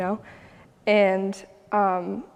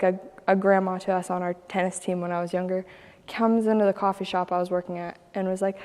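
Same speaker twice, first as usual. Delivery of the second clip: ordinary talk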